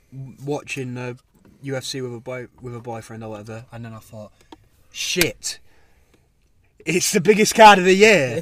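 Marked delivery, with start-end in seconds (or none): 4.9-8.4 loud laugh through the words; laughing exclamation. Retold or imitated. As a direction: blurt it out while laughing. Retold shock at missing event.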